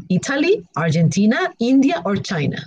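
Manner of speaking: normal talking voice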